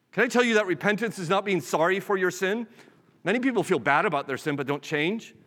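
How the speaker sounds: normal talking voice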